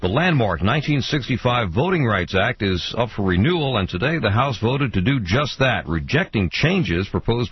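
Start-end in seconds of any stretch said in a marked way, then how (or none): none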